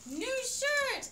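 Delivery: loud, high delighted cry; excited. Real, not acted. Toddler unwraps gift.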